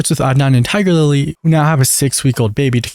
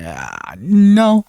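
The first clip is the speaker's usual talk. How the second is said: drawn-out, higher-pitched hedge; hesitant refusal; 7 semitones higher than usual. Retold or imitated. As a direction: imitate a reluctant answer after a long pause. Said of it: "pregnant pause before speaking and going".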